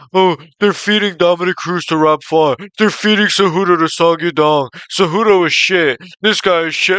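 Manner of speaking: hammed-up bitching and moaning; mocking complainers. Mocking fans who complain about the fights, whiny mock voice. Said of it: "just bitching and moaning".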